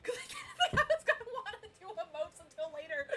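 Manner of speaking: loud, high and laughing; exasperated wail. Real, not acted. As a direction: wail the excuse, close to cracking up. Loudly explains blunder while laughing.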